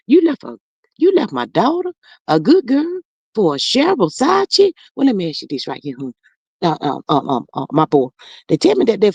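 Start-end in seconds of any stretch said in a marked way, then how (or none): 0.1-4.7 pitched up in disbelief, scolding; outraged mama. Acted. Mama scolds her son for leaving her daughter, outraged pitch. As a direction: demand it like a mother whose girl was wronged.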